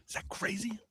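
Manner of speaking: unvoiced stage whisper. Shown as a demonstration. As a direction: whisper it like a secret. Repeated, second time whispered.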